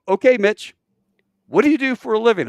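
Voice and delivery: high, loud, quick on-air question; radio host. Retold or imitated. Hosts asking Mitch his job, mock radio host. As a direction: quiz the caller like a show host.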